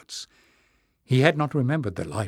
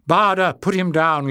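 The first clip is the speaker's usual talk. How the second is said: urgent plea, higher and louder; raised, pleading call; begging; 6 semitones higher, 5 dB louder than usual. A narrator's reading. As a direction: beg him to stop. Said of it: "Leaf begged".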